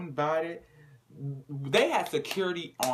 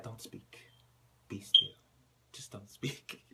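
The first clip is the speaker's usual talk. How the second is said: quiet, dismissive shushing; hushed; 4 semitones lower, 3 dB quieter than usual. Retold or imitated. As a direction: say it softly, dismissively. Marissa hushing Brittany.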